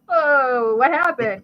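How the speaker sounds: lightly acted bewilderment; startled dad. Dad reacts to mom's explosion, mildly startled.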